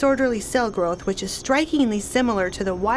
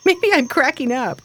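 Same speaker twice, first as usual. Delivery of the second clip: laughing; 4 semitones higher, 5 dB louder than usual. Acted. Laughing while worried.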